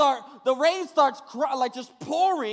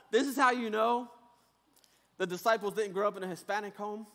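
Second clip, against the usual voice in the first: usual speaking voice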